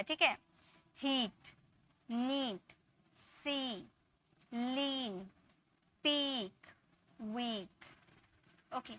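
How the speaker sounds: ordinary talk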